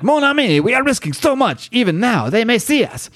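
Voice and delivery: put-on very high, lilting French accent; exaggerated French accent. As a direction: ham up the French accent, pitched right up. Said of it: "there, the French is back".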